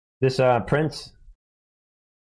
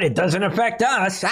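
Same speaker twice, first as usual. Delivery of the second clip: higher voice breaking into laughter; mocking glee; 5 semitones higher than usual. Mocking Saudis untouched by the pledge, laughing. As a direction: scoff it gleefully, about to crack up.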